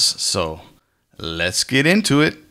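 ordinary delivery

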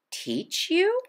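high, soft amazed question; can't believe he asks; the mother, astonished to be asked to teach; surprised mother. A narrator's reading.

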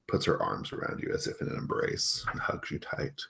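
ordinary talk

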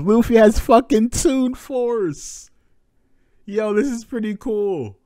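loud high slow yell of excitement; real excited shout; hype shout. Real, not acted.

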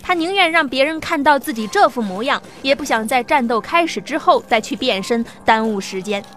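ordinary delivery, nothing marked

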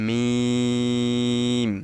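long, hummed মিম on the dry part of both lips; slow, steady demonstration of মিম; dry-lip letter. Shown as a demonstration. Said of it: "দুই ঠোঁটের শুকনা জায়গা".

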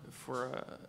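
ordinary delivery

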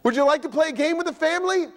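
high, light coaxing wife; wife nudging her grumpy husband, pitched high; wife asking. Retold or imitated. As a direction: coax a sulking husband gently. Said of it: "your wife says".

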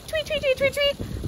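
high, chirpy tweeting; high birdsong imitation for the children; bird tweets. Shown as a demonstration. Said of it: "Can you guys make a bird sound".

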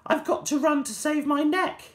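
put-on high, loudish cry of a girl in a panic; high girlish shout. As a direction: yelp it as midnight strikes. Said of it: "She shouted".

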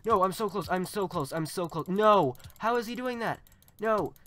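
high, very loud cries of disbelief; frantic gamer protest at full volume; panicked yelling. Real, not acted. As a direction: shout in desperate alarm. Losing a close fight in game.

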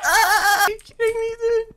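very high, squealed protest; exasperated wail. Real, not acted. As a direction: cry out in helpless disbelief. Gamer wails at a setback.

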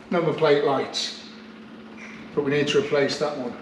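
ordinary delivery

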